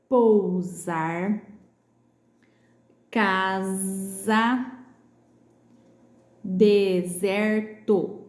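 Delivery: pousar, casa, deserto stretched out with a buzzing Z; slow syllables, voiced Z in "Pousar", "casa", "deserto". Shown as a demonstration. Teacher reading group A aloud, S as Z in "Pousar, casa, deserto". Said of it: "o S com som de Z".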